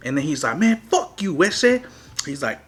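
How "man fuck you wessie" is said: higher-pitched imitation of an irate rival; mock angry retort. Rival cursing back at the storyteller, imitated anger. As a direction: act out his indignant comeback.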